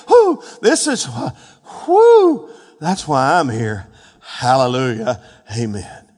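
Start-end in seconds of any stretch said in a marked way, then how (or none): 0.1-0.4 high, loud "whew"; fired-up preacher's whoop; excited whoop. Real, not acted. Preacher overcome by his own excitement. 1.8-2.5 very high, loud "whew"; fired-up preacher's whoop; excited whoop. Real, not acted. Preacher overcome by his own excitement.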